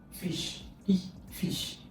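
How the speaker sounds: fish whispered, short i stressed; short vowel demo. Shown as a demonstration. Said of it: "Short E sound".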